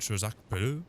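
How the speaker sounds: put-on a clumsy try at a French accent, rolling the name; mock the French butler's announcement, badly; failed French accent. Gamer copies the game's French name call in a botched French accent. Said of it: "I, I can't do that accent".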